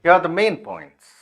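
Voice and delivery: measured announcement with weight on MAIN; newsreader sentence stress. Shown as a demonstration. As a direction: open like a bulletin, leaning on MAIN POINTS. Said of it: "ऐसे करके वो शुरू होता है".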